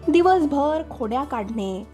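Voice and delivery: ordinary delivery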